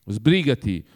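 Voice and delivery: ordinary delivery, nothing marked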